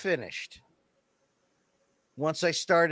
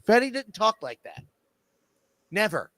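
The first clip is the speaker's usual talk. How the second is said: ordinary talk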